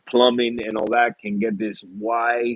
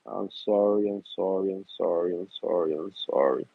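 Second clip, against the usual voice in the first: repeated sorries sinking lower and trailing off; fading mock apology; 4 semitones lower than usual. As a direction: apologize to the coral, dropping lower each time. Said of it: "I always say sorry".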